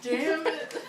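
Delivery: high, drawn-out exasperation; exasperated curse. Real, not acted. Player refused forgiveness after his roll, exasperated curse.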